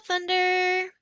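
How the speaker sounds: ordinary delivery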